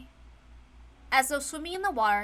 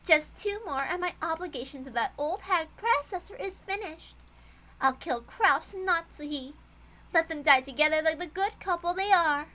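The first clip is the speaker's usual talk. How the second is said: cruel witch; 4 semitones higher than usual. Acted. Cruel new witch plots killings.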